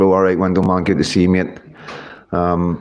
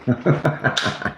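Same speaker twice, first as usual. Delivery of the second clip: soft, high chuckle; amused chuckle; 6 semitones higher, 5 dB quieter than usual. Real, not acted. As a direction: chuckle softly to yourself. Amused at spotting someone.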